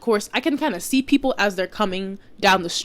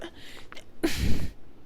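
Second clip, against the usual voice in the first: heavy exasperated sigh. Real, not acted.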